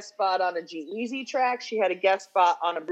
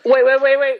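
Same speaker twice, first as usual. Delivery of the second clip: urgent interrupt; 5 semitones higher, 11 dB louder than usual. Real, not acted. Stopping co-host moving on.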